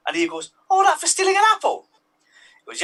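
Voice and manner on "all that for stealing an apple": Eve's voice pitched far up and louder, outraged; indignant Eve. Acted. Eve protesting her punishment to God, high indignant squeal.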